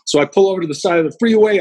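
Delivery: normal talking voice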